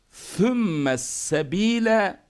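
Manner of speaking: "sümme" begins with th, then a plain S in "sebile"; slow "sümme sebile", only the first S lisped. Shown as a demonstration. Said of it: "birincisi peltek, sonrakiler normal sin".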